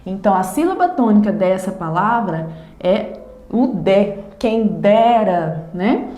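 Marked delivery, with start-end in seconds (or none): 4.4-5.7 "Quem dera" slowly, with DE as the strong syllable; stress on DE. Shown as a demonstration. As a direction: lean on DE in "dera", drawing it out. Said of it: "É a sílaba mais forte".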